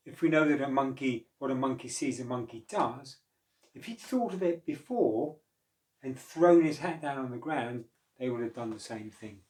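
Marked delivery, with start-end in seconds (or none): none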